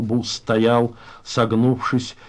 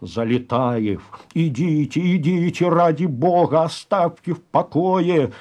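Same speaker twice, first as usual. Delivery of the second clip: urgent hushed pleading; 6 semitones higher than usual. Acted. Comrades beg Залетаев to give in, urgent pleading. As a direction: beg him in a thin, stretched voice. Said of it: "зашептали остальные".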